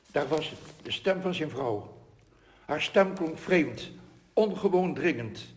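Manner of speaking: ordinary talk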